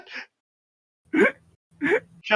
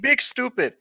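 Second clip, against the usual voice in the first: higher, mocking schoolyard insult; childish taunt; 6 semitones higher than usual. Mocking Edward in the roleplay, childish taunt. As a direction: tease him like a sulky kid.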